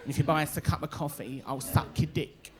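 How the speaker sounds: a lightly voiced junkie, quiet and low; a hint of a low, quiet scrounger; junkie woman. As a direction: mumble it like a lightly done junkie begging. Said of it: "And she's like".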